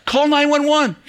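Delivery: high yelled call for help; panicked yell. Retold or imitated. Husband yells for help when his wife collapses, a high yell. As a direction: yell for an ambulance in alarm. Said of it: "he yells".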